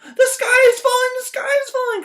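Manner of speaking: very high, loud hen shriek; clucking panic. Acted. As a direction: shriek over him in terror. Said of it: "clucked Chicken Little".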